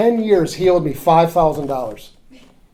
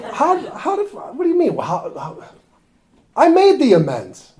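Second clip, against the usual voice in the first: stammering, offended protest, pitched way up; flustered indignation; 11 semitones higher, 5 dB louder than usual. Retold or imitated. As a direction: sputter in high-pitched disbelief, then insist loudly. Flustered reaction to sponsor's question.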